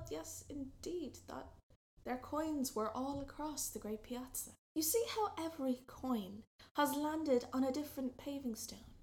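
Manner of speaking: usual speaking voice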